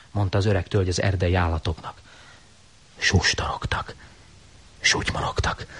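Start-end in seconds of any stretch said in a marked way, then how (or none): 3.0-5.7 unvoiced hushed murmur; whisper. A narrator's reading. Narrator whispering the forest's murmuring. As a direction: whisper it like rustling.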